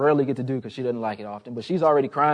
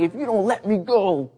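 higher-pitched mock protest; mock baby tantrum; 6 semitones higher than usual. Newborn fighting dad's tight hold, mock angry protest. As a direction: kick up a fuss like a cross baby. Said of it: "She's kicking like".

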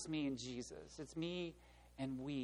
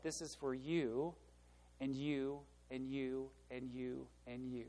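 you, and you, and you, each you pointed and fading softer; stress on each you; 3 semitones lower, 7 dB quieter, slower than usual. Shown as a demonstration. Pastor singling out each person in the church, each you stressed. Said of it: "what he says is what".

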